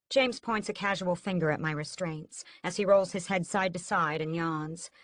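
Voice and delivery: plain reading voice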